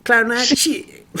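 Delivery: drawn-out, high Southern twang; mock Southern accent. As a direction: stretch it like a hammed-up Southerner. Playing along with the Southern accent.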